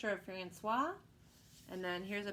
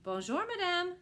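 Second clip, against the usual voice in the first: much higher, courtly bonjour; polite greeting; 11 semitones higher, 4 dB louder than usual. A narrator's reading. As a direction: sing it up politely. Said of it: "the happy lion nodded".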